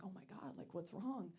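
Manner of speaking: ordinary talk, nothing marked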